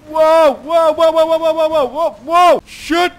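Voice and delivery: high, loud run of whoas; panicked whoas. Real, not acted. Gamer yelling as his tricycle nearly flips. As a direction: yell as you tip over. Tipping over during a chase.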